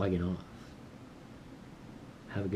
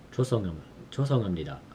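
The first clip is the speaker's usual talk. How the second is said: 죄송합니다 rushed at double speed; rapid practice; much faster than usual. Shown as a demonstration. Learner drilling a polite apology, very fast. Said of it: "making sure I say it right".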